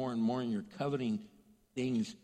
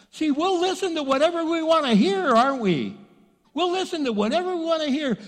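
much higher and louder preacher's cry; preaching shout; 13 semitones higher, 10 dB louder than usual. Acted. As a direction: preach it out at full force. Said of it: "preaching at full force".

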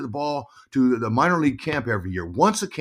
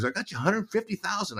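high, softer imitation of mom; matter-of-fact mom; 10 semitones higher, 7 dB quieter than usual. Mom telling son the money, high soft voice. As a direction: imitate mother revealing the figure. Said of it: "She goes".

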